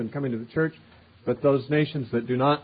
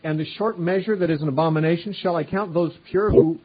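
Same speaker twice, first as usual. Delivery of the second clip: higher, louder proclamation; scripture reading; 4 semitones higher, 4 dB louder than usual. Acted. Preacher reading the Lord's cry to the city, higher and louder.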